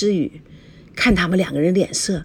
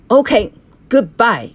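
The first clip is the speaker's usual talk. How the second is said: slow, drawn-out, louder brush-off; curt goodbye; 3 semitones higher, 5 dB louder, much slower than usual. A narrator's reading. Fiancé storming off, drawn-out cold goodbye. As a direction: spit out a dismissive goodbye. Angry parting shot in English.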